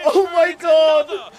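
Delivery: slow, high, loud disbelief; ecstatic shout. Real, not acted. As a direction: scream it at the win. Team just won the series.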